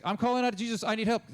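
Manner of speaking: high, loud desperate call. Retold or imitated. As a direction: shout for help over the crowd. Beggar calls louder to Jesus.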